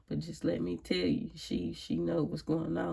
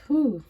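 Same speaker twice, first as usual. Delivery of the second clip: loud relieved whew; 9 semitones higher, 12 dB louder than usual. Real, not acted. Exhaling after talking about faith.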